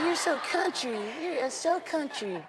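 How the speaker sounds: put-on Michael teasing Chris's accent. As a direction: tease in a soft high voice. Voices Michael laughing.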